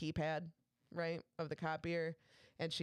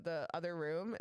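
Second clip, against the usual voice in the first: usual speaking voice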